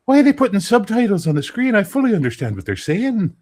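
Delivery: high, loud mock Irish lilt; Irish girl. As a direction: imitate an indignant Irish girl. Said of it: "And she's like".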